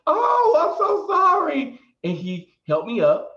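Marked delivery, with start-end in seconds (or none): 0.1-1.8 high, loud, drawn-out mock apology; mortified apology. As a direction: imitate someone aghast at their own mistake. Retold man's shocked apology on learning mistake.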